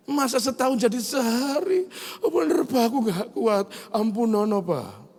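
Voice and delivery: very high whiny begging; whiny child. Retold or imitated. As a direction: plead like a crying boy. Son keeps begging.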